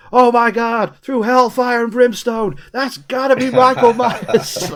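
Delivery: high, loud, drawn-out proclamation; mock awed exclamation. Acts out his awed reaction.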